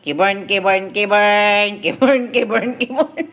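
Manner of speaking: low, repeated sing-song name; teasing name-calling. Real, not acted. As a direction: coax a sulky pet by name, low. Coaxing the sulking dog by name. (mababang panunukso sa alaga)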